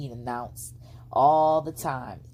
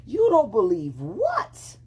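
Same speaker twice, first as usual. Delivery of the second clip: slow, higher indignant fox; fox asking; 7 semitones higher, 5 dB louder, slower than usual. A narrator's reading. Fox puzzled by the girl's doubt, slow and indignant. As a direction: drag out the disbelief. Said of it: "Fox asked".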